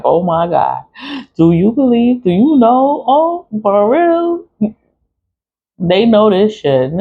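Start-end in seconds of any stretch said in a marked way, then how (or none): 0.0-4.7 mocking her kids. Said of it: "They're like".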